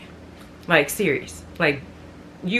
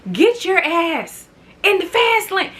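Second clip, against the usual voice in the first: imitating a driver yelling get over; mock rude tailgater; 10 semitones higher than usual. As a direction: sneer it like the tailgater. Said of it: "It's like".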